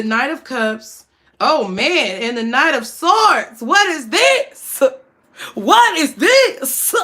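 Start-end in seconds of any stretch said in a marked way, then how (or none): 3.6-4.8 slow, high preacher intonation; drawn out like a pastor at the pulpit; church voice. Acted. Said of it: "in the church voice". 5.5-6.9 slow, high preacher intonation; drawn out like a pastor at the pulpit; church voice. Acted. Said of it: "in the church voice".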